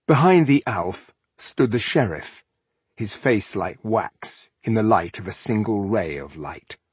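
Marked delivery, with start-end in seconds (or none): none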